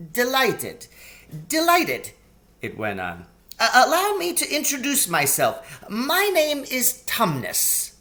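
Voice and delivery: a polite, flustered faun covering a slip; high eager faun babbling; excitable faun. Acted. Faun character voice.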